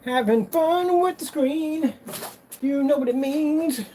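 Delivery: high, slow playful voice; playful sing-song. Real, not acted. Showing off the screen in a high playful voice. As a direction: drawl it out high and teasing.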